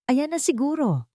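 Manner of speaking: high, delighted Alvaro; eager, pleased it's arriving. Acted. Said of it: "Tuwang-tuwang sabi ni Alvaro".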